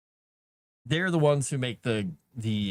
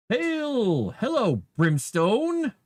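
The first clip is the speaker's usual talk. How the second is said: excited greeting; 12 semitones higher, 5 dB louder, much slower than usual. Real, not acted. Greeting a chat member on stream.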